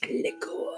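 Liqueur whispered breathily with a French sound. Real, not acted.

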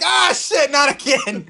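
loud, high groan of dismay; high, loud comic despair; exasperated yell. Acted. Acted player attacked by lion.

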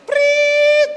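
sharp high mock referee whistle; piercing whistle sound done with the mouth; whistle blow. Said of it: "wanita tiup peluitnya".

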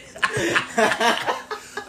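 high breathy laughter; loud laugh. Real, not acted. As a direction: burst out laughing. Laughing at own story.

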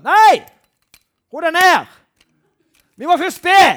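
high, loud objecting shout; loud quarrel cry; prayer type shouting back. Retold or imitated. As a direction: yell back to calm the evangelist down. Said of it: "Så sier han andre".

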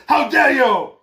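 high, loud indignant cry; mock outraged shout. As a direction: imitate a pompous scold. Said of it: "[name] is mad".

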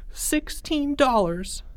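put-on high, slow boy voice; high drawn-out childlike answer; sheepish kid. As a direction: draw it out sheepishly. Boy confesses small sum.

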